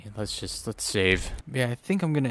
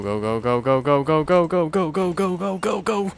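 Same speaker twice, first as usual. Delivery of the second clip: frantic urging, rising; 6 semitones higher, 6 dB louder than usual. Real, not acted. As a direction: go go go, climbing higher and louder. Running past the monster.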